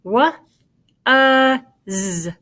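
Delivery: "Wa, uh, z" as three sounds with gaps between; sounding out. Shown as a demonstration. Teacher making the sounds so pupils can check, sounding out. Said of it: "Let's make the sounds one more time".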